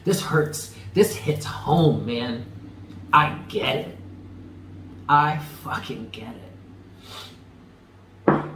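choked up, half-whispered through tears; fighting back real tears; tearful. Real, not acted. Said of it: "you're making me cry because this hurts".

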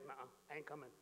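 low, soft stubborn refusal; sulky refusal. Acted. Older son refusing the bouncer, low and soft. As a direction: mutter a flat no at the door.

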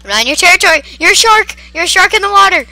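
loud, high-pitched protest; frantic high shouting; panicked yelling. Real, not acted. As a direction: scream it at the attacker in alarm. Yelling at attacking shark.

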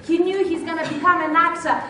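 loud, high, forceful declaration; emphatic certainty. Real, not acted. Speaker stresses actor's certainty to audience, emphatic. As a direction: drive it home with a shouted final word.

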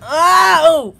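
loud, high gasping cry of effort; effortful cry. Pretend. The kid tops the box with a loud high cry. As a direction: let it burst out after a hard pull.

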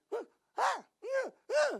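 high, loud irritated huffs; acted huffs of shaking someone off; mock huffing. Imitates wife shrugging off hugging husband. (dengusan kesal pura-pura, tinggi dan keras)

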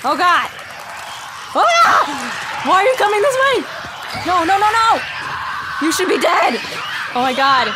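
loud, high yelps of alarm; panicked shouting. Real, not acted. Gamer as enemies come this way, panicked shouting. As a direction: shout in a real gaming panic. Enemies rush the player mid-game.

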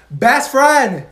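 loud, high happy call; excited greeting. Acted. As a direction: greet her with big excitement.

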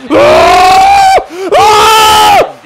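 loud, very high shriek of frustration; two piercing screams of disbelief; high-pitched scream. Real, not acted. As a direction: shriek wordlessly at the disaster. Screams at a bad play.

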